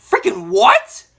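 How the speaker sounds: loud, high-pitched disbelief; high, loud incredulous question; incredulous outburst. Acted. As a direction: blurt it out in shocked disbelief. Reacts in disbelief to pregnancy plot.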